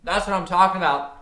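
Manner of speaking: sudden high, loud cry of delight; excited outburst. Real, not acted. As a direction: blurt it out, thrilled. Trade suddenly hits target.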